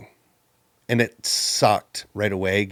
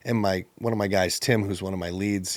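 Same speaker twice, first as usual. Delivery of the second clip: ordinary talk, nothing marked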